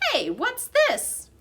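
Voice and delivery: high, slow surprised call; curious janitor. A narrator's reading. Aldo spots the blue ribbon, surprised. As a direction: spot something and lean in.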